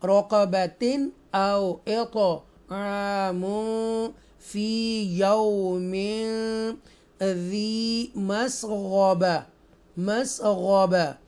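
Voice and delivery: slow, drawn-out tajweed chant; Quran recitation. Shown as a demonstration. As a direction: recite each word slowly and melodically.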